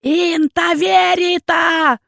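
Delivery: high, slow furious yell; the leader's drawn-out shout. A narrator's reading. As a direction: bellow the name, dragging it out. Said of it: "орал лидер".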